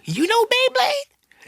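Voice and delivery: squeaky high disbelief; shocked falsetto question; surprised. Retold or imitated. Amazed dad, very high squeak.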